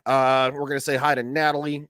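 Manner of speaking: normal talking voice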